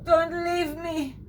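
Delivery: high, slow, melodramatic plea; pleading lover. Pretend. Lovesick woman begging her prince to stay. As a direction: beg him not to go, hammed up.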